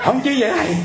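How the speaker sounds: very high, wailing objection, imitated; distraught protest. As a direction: mimic the caller balking at an order. Caller protests at order to back away. (giả giọng phản đối the thé)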